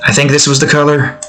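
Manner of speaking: usual speaking voice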